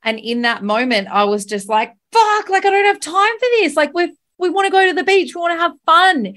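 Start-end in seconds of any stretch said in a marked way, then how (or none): 2.1-6.3 much higher, louder, exasperated outburst; frantic, fed-up venting; retold rage. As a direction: relive the moment you lost it. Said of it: "once I raged probably about five times like that".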